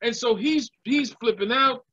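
normal talking voice

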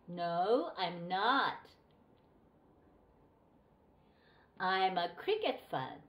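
slow dialogue read with long gaps to repeat; slow model reading. Shown as a demonstration. Teacher reading the dialogue for students to repeat, slow and clear. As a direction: read each line slowly and clearly for the class. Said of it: "後についてくださいね".

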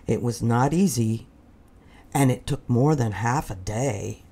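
ordinary delivery

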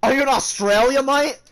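high, loud mock Australian accent. Gamer asks stranger about Australia, mock Australian accent. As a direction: put on Aussie, lifting into the question.

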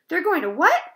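high, loud shocked question; screamed. A narrator's reading. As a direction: cry out, leaping up on "what". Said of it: "screamed Wilbur".

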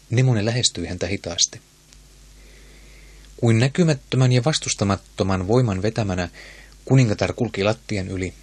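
plain reading voice

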